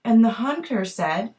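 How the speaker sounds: ordinary delivery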